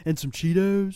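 laid-back hippie voice; stoner voice. Retold or imitated. Stoner joke continued.

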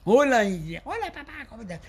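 put-on high child voice greeting dad; mock child's phone hello; little daughter on phone. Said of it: "Pásame a Jimena".

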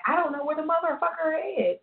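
a hint of a diva quoting herself. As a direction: lightly mimic the singer's indifference. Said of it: "I mean, she said".

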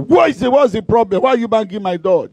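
high-pitched retold irritation; annoyed outburst. Woken woman to whoever bangs her door, annoyed outburst. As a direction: start high and exasperated, then rush the question.

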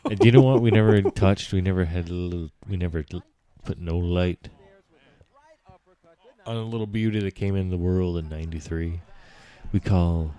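loud ring announcer build-up; hype announcer. Pretend. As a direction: belt it out like a fight promoter. Hyping UFC like an announcer.

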